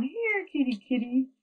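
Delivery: high coaxing call to a cat; calling kitty. Acted. Child calling the cat to bed with a sweet high voice.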